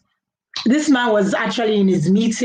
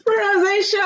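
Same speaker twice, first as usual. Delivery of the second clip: laughing shriek; 12 semitones higher than usual. Real, not acted. Laughing about wrong pronunciation.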